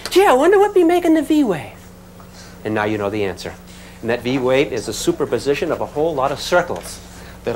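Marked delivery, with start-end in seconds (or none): 0.1-1.7 high-pitched mock wondering voice; playful high voice of a puzzled observer; wondering onlooker. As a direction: wonder aloud in a silly high voice. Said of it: "you've always said".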